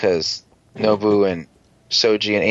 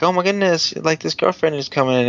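mock excited; 6 semitones higher than usual. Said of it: "they're all like".

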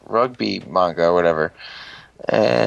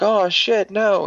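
alarmed cry; 9 semitones higher, slower than usual. Retold or imitated. Candelilla sees Nobu about to die.

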